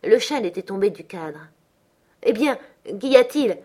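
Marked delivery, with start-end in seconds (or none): 2.2-3.6 plus fort et plus aigu, interpellation vive; cri. A narrator's reading. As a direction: crier pour la tirer de sa frayeur. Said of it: "criai-je". (appel crié, voix montée)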